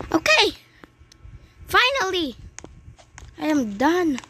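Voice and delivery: high, loud cry of relief at the top; finally done, celebrate loudly; real relieved cheer; relieved triumph. Real, not acted. Reaches the top of tower.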